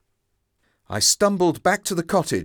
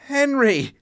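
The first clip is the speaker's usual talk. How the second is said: crying out; 11 semitones higher than usual. Acted. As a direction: cry out his name in fear. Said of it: "I cried".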